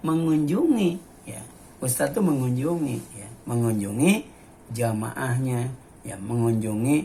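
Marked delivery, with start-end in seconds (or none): none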